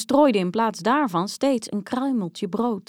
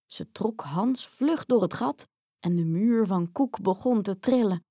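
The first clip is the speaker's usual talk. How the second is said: ordinary delivery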